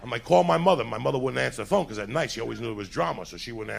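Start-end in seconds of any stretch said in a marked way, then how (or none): 0.2-0.8 shouted demand. Retold or imitated. Kid demanding in the fight.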